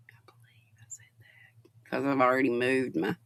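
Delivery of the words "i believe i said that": whispered to herself; muttering a number to herself; whispered aside. Real, not acted. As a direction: say it under your breath.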